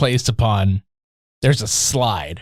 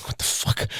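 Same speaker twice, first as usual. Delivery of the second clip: imitated stunned whisper; whispered disbelief; 10 dB quieter than usual. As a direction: whisper it, mock-baffled. Voicing the sidekick's reaction.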